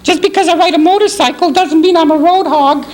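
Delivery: very high falsetto puppet voice; hammed-up lovesick puppet; puppet sweetheart impression. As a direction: plead with a sweetheart in a piercingly high put-on voice. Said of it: "I'm gonna do the impression".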